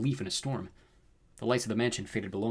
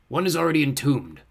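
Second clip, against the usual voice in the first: loud, raised, imperious delivery; fiery villain lord; 4 semitones higher, 6 dB louder than usual. A narrator's reading. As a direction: pronounce it like a dark overlord. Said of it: "the fiery man said".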